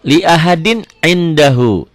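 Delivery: drawn-out, chanted Arabic reading; measured tajwid recitation; slow Quran recitation. Shown as a demonstration. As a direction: recite it slowly, stretching each vowel. Teacher recites Quranic phrase.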